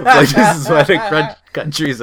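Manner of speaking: loud, high words broken by laughter; laughing while talking. Real, not acted. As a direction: push the words out through laughter.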